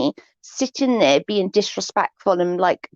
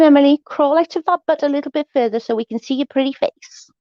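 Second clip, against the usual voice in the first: mocking sarcastic jab, pitched up; bitchy sarcasm; 6 semitones higher than usual. Real, not acted. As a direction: sneer it at her sweetly. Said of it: "Okay, I'm being a bitch now".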